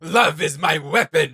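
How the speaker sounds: slow, overdone brooding mock of the line; mocking edgelord. Gamer mocking Viego's voice line, slow mock brooding. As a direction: ham up the edgy villain, mocking. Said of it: "comes off like a total edgelord".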